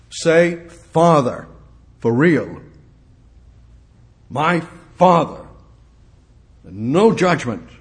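loud, high, slowing preaching climax; full-force preaching. Acted. Preacher crying out to the congregation, loud rising preaching. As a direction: build to a ringing crescendo.

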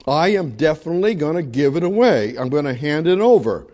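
ordinary delivery

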